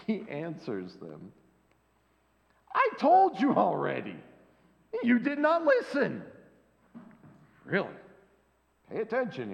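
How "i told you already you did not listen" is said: high, loud, fed-up retort; fed-up blind man scolding the Pharisees; exasperated blind man. Acted. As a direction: snap back, tired of repeating yourself. Frustrated man answering the Pharisees.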